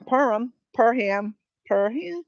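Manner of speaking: "Perham" as per-HAM, a clear h and full second vowel; split into two words. Shown as a demonstration. Streamer trying out a viewer's surname, "Perham" split as per-ham. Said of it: "or Perham".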